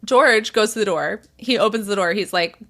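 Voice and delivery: plain reading voice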